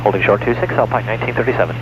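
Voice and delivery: low, fast radio call; pilot over the radio. Real, not acted.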